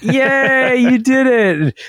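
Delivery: high, slow cheer; cheering parents. Retold or imitated. Parents cheering their son's finished puzzle, high excited praise. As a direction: praise the toddler like it's huge. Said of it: "And we're like".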